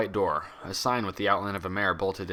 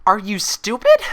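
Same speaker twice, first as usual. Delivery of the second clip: much higher, much louder snap; snapped; 13 semitones higher, 13 dB louder than usual. A narrator's reading. Twilight snapping furiously at her reflection, much higher and louder. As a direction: bite the question off in fury. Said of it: "she snapped".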